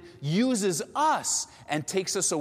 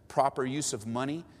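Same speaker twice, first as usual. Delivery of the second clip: usual speaking voice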